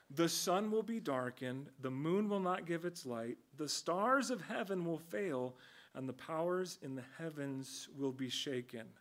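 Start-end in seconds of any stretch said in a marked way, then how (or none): none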